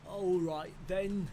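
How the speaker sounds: put-on Australian accent. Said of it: "do I just do an Australian accent".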